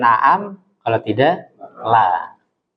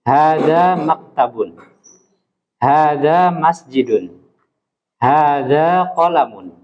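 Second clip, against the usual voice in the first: slow, each line falling at the end; teacher modelling statement intonation; statement tune; slower than usual. Shown as a demonstration. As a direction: read them like calm statements. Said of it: "mengucapkannya dengan pernyataan".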